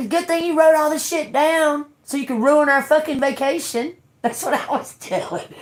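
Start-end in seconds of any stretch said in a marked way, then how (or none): 0.1-3.9 high-pitched sarcastic jab; retold taunt. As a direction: needle him in a raised pitch. Retelling her taunt to him.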